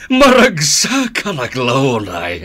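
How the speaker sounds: slow, loud, gloating praise that breaks into a laugh. Acted. Father gloating over his son, slow and loud, laughing. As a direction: savour the praise, then laugh like a proud villain.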